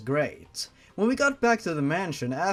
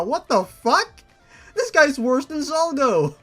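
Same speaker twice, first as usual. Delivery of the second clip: shocked outburst; 9 semitones higher, 7 dB louder than usual. Real, not acted. Shocked by abuser's threat.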